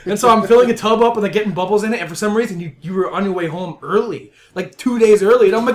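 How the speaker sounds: normal talking voice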